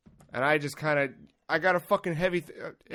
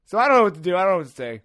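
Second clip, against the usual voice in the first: usual speaking voice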